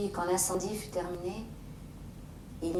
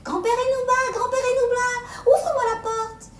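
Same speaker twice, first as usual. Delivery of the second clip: put-on high child calling at the door; high, loud little girl calling; little girl's voice; 9 dB louder than usual. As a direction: sing out sweetly like a little girl. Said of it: "elle disait".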